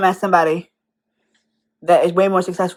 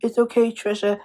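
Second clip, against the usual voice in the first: soft, slightly raised mock consoling; mock tearful sympathy; 2 semitones higher, 5 dB quieter than usual. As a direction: imitate fans tearfully comforting her, quietly. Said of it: "and crying and saying".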